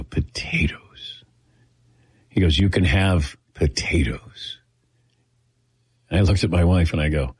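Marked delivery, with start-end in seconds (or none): 0.0-1.2 slow, breathy disbelieving whisper; stunned whisper. Retold or imitated. Echoing doctor in disbelief. 2.6-4.6 slow, low whispered imitation of the doctor; doctor's slow whisper. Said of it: "And he talked like this".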